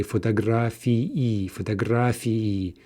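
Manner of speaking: slow, every vowel of фотографии clear, the ending ии as two full syllables; said very clearly. Shown as a demonstration. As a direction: say фотографии slowly, sounding both и of the ending. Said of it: "if we're saying that very clearly".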